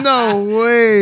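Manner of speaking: high, loud laughing disbelief; shocked laugh. Real, not acted. Finding out he was wrong.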